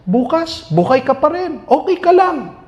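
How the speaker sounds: high, sly tempting whisper-free coax; devil's coaxing voice. Retold or imitated. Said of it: "The devil convinces us".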